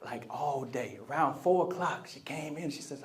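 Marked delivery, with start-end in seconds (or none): none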